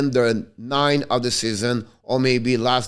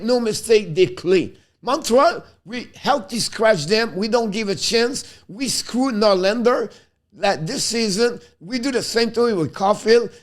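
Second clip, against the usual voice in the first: frustrated rant; 6 semitones higher than usual. Real, not acted. Fan ranting at Montreal's coaching decisions, frustrated and higher. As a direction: pitch climbing higher, heated and exasperated. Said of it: "I'm getting frustrated right now".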